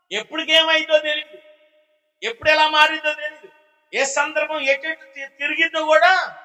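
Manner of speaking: high-pitched pulpit exhortation; impassioned preaching. Real, not acted. Preacher raises voice to drive point.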